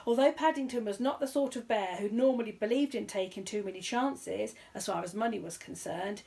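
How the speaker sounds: usual speaking voice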